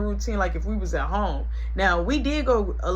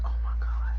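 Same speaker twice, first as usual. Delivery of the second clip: hushed whisper; 4 dB quieter than usual. Retold or imitated. Brother whispering while hiding, hushed.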